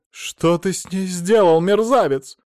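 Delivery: high, loud, drawn-out accusation; furious demand. A narrator's reading. Mother-in-law suspects he harmed her daughter, furious demand. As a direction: demand an answer in alarm.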